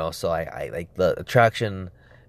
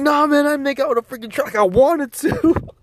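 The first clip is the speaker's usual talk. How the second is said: high-pitched, agitated denial; hasty protest; 4 dB louder than usual. Retold or imitated. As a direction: protest in a mock panic. Acting out his protest to friends.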